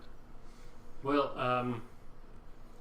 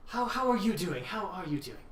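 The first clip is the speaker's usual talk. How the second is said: high, posh British barkeep greeting; a posh British welcome, all politeness; posh British innkeeper, bright and high; 7 semitones higher than usual. Acted. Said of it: "he was very kind of British and posh".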